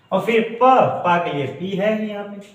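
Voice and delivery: ordinary delivery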